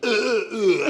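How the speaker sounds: short high strained grunts, hammed up; mock dying grunts. A hammed-up dying grunt for the interviewer. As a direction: play a quick death with grunts. Acting out his quick death.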